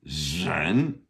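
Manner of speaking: "Zhen" with a long buzzed initial and rising tone; SH meeting R. Shown as a demonstration. Teacher checking learners can hear it, SH meeting R. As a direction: blend sh into r on "Zhen", then lift. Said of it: "like an S H meets an R".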